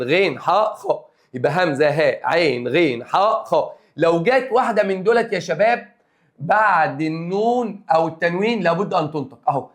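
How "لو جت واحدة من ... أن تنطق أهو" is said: normal talking voice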